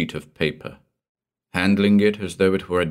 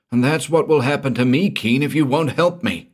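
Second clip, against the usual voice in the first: higher, louder, panicked cry; panicked shout; 9 semitones higher, 4 dB louder than usual. Acted. Terrified man begging the detective, panicked shout. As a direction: cry out in terror. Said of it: "he shouted".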